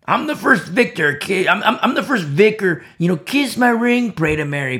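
high, loud mock boasting; mocking imitation of self-importance; mock pontiff. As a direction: proclaim it like a hammed-up pope. Mocks Peter as a grand pope.

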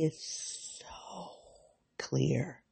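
barely voiced, choked whisper. Real, not acted. Describing a clear vision, a choked whisper. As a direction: breathe the word out through tears.

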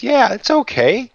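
high, slow reassurance. Retold or imitated. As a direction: ease her worries in a lifted, drawn-out voice. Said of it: "He goes".

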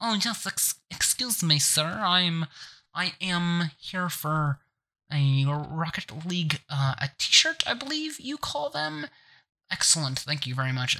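a timid man in thick glasses, lightly voiced; a nerd in thick glasses politely asking for a shirt; nerdy gentleman. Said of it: "really thick glasses, and I'll be like".

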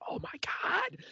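shocked whisper; hushed whisper in class. Retold or imitated. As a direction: gasp it out under your breath. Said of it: "she's like".

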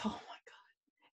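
breathy under-the-breath whisper; exasperated whisper. Retold or imitated. Teen whispering to herself during dad's lecture, exasperated. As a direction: whisper it like a teen rolling her eyes.

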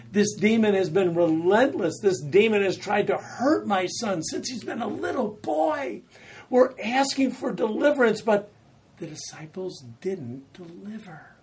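high, loud anguished plea; father pleading with Jesus to deliver his son, loud; desperate pleading. Retold or imitated. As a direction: beg with everything you've got. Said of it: "cries out to Jesus".

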